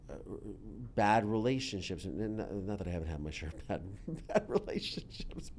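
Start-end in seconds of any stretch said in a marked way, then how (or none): 4.1-5.5 high, breaking into a laugh; chuckled, self-mocking aside. Real, not acted. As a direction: laugh through the words. Laughs at own admission.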